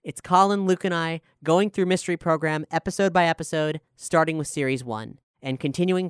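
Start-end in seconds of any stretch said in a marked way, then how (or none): none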